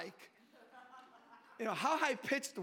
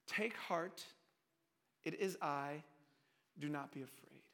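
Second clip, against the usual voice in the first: low, hushed, spaced-out phrases; soft reassurance; 9 semitones lower, 9 dB quieter than usual. Acted. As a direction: soothe them quietly, pausing between phrases. Repeats Jesus' words softly, reassuringly.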